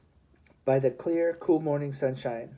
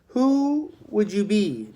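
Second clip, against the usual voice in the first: slow, loud, higher demanding question; demanding sergeant; 6 semitones higher, 5 dB louder, slower than usual. A narrator's reading. Sergeant demands the stowaway's name, slow and loud. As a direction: press the boy for his name. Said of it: "demanded the soldier".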